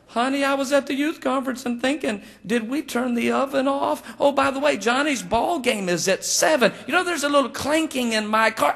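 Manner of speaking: high, run-on mock-female voice; chattering wife. Wife telling her husband everything at once, chattering. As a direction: imitate a wife hopping topic to topic, pitched high.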